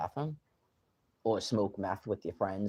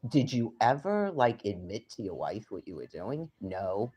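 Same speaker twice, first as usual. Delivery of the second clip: ordinary talk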